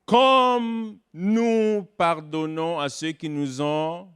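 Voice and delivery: slow solemn liturgical intoning; recited prayer. Retold or imitated. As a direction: chant the line like liturgy. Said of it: "Il y en a qui peuvent pas continuer l'autre partie".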